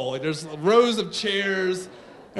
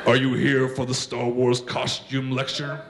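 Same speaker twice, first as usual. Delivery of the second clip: low, monotone villain voice; deep, measured menace after a mechanical breath; Darth Vader; 8 semitones lower than usual. Acted. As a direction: intone it like a masked dark lord. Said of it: "why does he talk like that".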